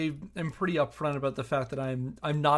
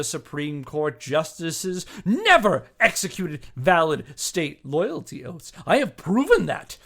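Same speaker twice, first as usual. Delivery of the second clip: louder, declaiming each charge like a prosecutor; dramatic letter reading; 4 semitones higher, 5 dB louder than usual. Acted. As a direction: read it out as a righteous indictment. Reads the accuser's letter aloud.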